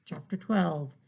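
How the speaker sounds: ordinary delivery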